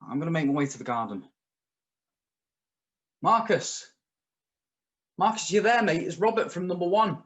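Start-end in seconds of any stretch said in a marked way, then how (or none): 3.2-7.2 raised, uneasy call into the dark. Acted. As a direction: call out to an unseen neighbour. Calling to neighbour in garden.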